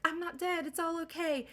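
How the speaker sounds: high-pitched, cheery imitation of reassuring someone; mock reassurance. Son imagines hugging his dad, mock reassurance.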